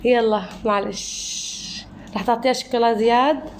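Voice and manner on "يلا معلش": drawn-out يلا، معلش, slow and soothing; slow consoling. Real, not acted. Consoling Mira after her mistake.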